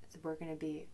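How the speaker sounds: ordinary talk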